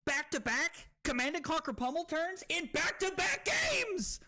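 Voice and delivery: high, building excited yell; incredulous hype. Real, not acted. Streamer celebrates back-to-back wins, excited yelling. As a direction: crow it out, amazed.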